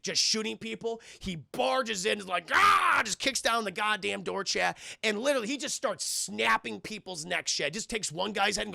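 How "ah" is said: high, loud mock roar; imitated battle yell. Mel barges in on rampage, mock battle yell. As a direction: yell as if kicking a door in.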